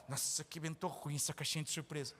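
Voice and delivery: low, soft whiny grumble; grumbling worker. Retold or imitated. Preacher mocks a worker complaining about the factory, low whiny grumble. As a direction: moan like a never-satisfied worker.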